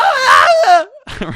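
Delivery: high, loud comic cry; hammed-up wailing cry; mock scream. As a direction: let out a cartoon yelp as you're tackled. Mock scream as player gets hit.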